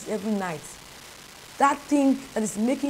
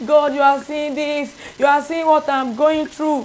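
higher, louder, whining lament at God; mock crying complaint; 5 semitones higher, 6 dB louder than usual. Preacher mimicking people who only cry to God, whining. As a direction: wail it like someone crying to God. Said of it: "they just cry".

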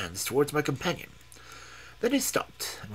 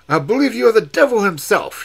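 loud, higher, startled outburst; cry out; 9 semitones higher, 14 dB louder than usual. Acted. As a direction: burst out in alarmed disbelief. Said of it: "he cried".

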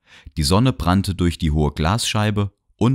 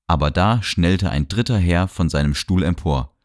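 usual speaking voice

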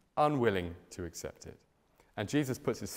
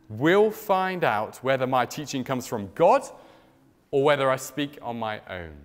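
scripture reading; 3 semitones higher, 6 dB louder than usual. Acted. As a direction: read the verse out with weight to the hall. Reads Jesus's words from verse sixteen.

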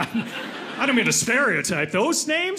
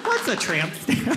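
slow, put-on little kid voice; grandchild; 3 semitones higher, slower than usual. Grandkid asking grandpa about grandma's tattoo, slow mimicked child. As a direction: ask innocently, as an imitated small child. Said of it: "their kids are gonna go up to 'em".

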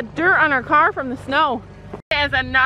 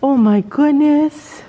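gushing; 3 semitones higher, 5 dB louder, slower than usual. Real, not acted. Vlogger gushes over the cat's cuteness. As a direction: melt over the cute cat.

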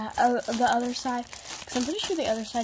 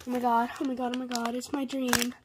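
ordinary delivery

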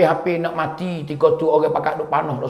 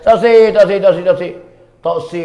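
acted insistent refusal; 5 dB louder than usual. Bilal turns down Umar, acted insistent refusing. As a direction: refuse sharply, over and over.

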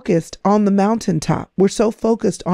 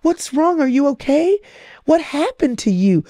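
put-on higher, gentle questions to a crying child; soothing a toddler; 7 semitones higher than usual. Parent asking a crying two-year-old what's wrong, tender and high. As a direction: coax a little one to say what's wrong. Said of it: "a little two-year-old just starts crying".

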